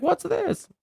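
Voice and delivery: very high mock-clueless question; baffled kids. Kids picking up a landline, high mock bafflement. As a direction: imitate kids puzzled by an old gadget. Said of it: "they're like".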